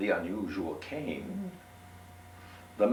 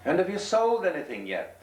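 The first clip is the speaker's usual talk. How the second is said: high, louder innkeeper voice; 12 semitones higher, 5 dB louder than usual. Acted. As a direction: voice the weary innkeeper, pitched up. Innkeeper asks gentleman.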